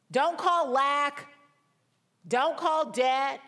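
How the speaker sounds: slow, emphatic preaching of "call"; stress on CALL. Acted. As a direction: hammer each "call". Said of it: "preaching emphasis".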